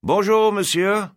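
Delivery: high, loud call in French. Acted. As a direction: call a cheerful greeting across the shop. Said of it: "he called out".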